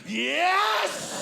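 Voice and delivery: high, loud little victory shout; retold triumphant yes. Comic cheers to the valet after his joke lands. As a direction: cheer a quick win to the valet. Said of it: "I look over at the valet and I went".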